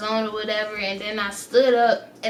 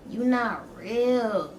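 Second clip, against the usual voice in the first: very slow, softer you not real; slow and spaced; 4 dB quieter, much slower than usual. Retold or imitated. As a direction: drag out each word, dazed. High, staring at stepmom.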